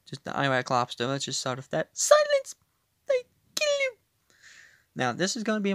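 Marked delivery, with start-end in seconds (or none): 1.9-4.0 very high, loud shouted villain line; mock card voice. Gamer mimicking a card's voice line, shouting. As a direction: bark it like a game character.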